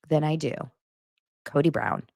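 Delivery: normal talking voice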